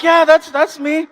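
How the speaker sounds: trying to keep the fan scream inside; holding it in. Retold or imitated. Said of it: "But outside, I'm like".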